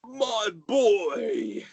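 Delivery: high, delighted cry of welcome; joyful greeting. Acted. The merry father greeting his returning son, high and delighted. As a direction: burst out with fatherly joy.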